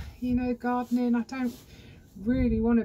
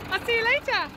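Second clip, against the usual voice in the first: cheery goodbye; 12 semitones higher than usual. Real, not acted.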